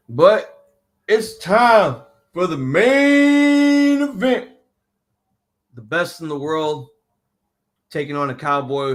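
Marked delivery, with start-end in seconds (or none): 0.1-4.5 loud, high hype with a hugely stretched "main"; ring announcer call. Pretend. Host bringing on the main event, booming drawn-out announcer call. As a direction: play the announcer, holding MAIN long.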